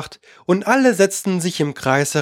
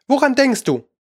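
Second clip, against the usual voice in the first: high, strict questioning; stern; 9 semitones higher than usual. A narrator's reading. Mouse questioning Alice, stern. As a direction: demand an answer from a daydreamer. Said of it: "sagte die Maus streng zu Alice".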